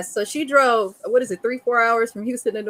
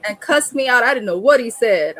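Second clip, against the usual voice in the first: usual speaking voice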